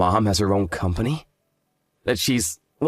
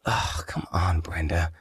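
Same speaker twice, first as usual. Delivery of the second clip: whisper; 6 semitones lower, 6 dB quieter, slower than usual. Acted. Groom pleading with annoyed fiancée.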